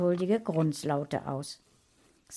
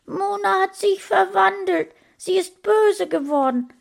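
crying; 13 semitones higher, 8 dB louder than usual. A narrator's reading. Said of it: "weinte Sascha".

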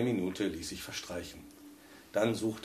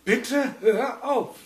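much higher, slow pressed plea; strained pleading; 13 semitones higher, 6 dB louder, much slower than usual. A narrator's reading. Husband pleads with the haunted radio, strained. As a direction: squeeze out a desperate please. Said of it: "mit gepresster Stimme".

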